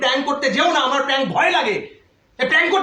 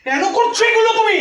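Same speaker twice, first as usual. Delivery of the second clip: high, raised-voice demand for an answer; angry scolding; 8 semitones higher, 4 dB louder than usual. Real, not acted. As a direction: scold in exasperation, pitch jumping up. Real scolding over a prank. (রাগে গলা চড়িয়ে বকুনি)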